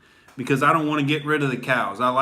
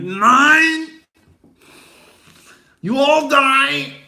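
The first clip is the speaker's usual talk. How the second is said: much higher, much louder ranting shout in mock German; Hitler ranting; 13 semitones higher, 7 dB louder, much slower than usual. Hitler yelling back at the girls, a hammed-up furious German rant. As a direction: scream it like a hammed-up dictator. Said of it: "And he's like".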